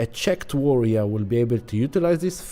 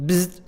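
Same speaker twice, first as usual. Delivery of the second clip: short buzzing zap noise; quick vocal buzz; electric zap; 7 semitones higher than usual. Retold or imitated. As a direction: imitate an electric shock. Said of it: "Uranus is zapping us when it's like".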